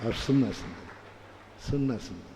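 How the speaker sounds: hushed low coaxing, listen now; hushed call to listen. Real, not acted. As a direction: draw the crowd in quietly. Preacher hushes audience to listen.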